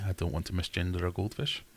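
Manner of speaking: ordinary delivery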